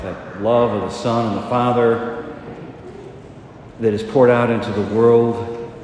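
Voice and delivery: full-force preaching, high and loud; fervent preaching. Acted. Priest urging his congregation to trust the Holy Spirit, fervent. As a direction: rouse the congregation to act.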